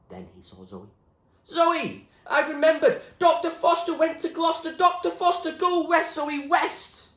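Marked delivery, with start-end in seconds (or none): 1.4-7.1 high, loud frantic rant; raving shout. Acted. Mad William shouts riddles at Zoe, frantic and loud. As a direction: shout it like a man unhinged.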